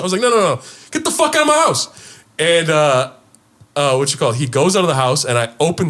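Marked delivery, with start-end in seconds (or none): 0.2-1.9 high, forceful order to leave; retold angry eviction. Host kicking guest out of the party, angry. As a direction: throw him out, indignant.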